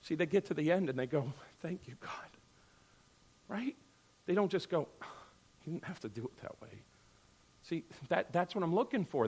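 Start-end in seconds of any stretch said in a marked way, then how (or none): 1.6-2.3 soft, grateful hush of a thank-you. Retold or imitated. Disciples quietly thanking God at the road's end, hushed. 5.6-6.8 quick, low grumble under the breath; muttered complaint. Retold or imitated. Believers quietly grumbling about how God acted, muttered.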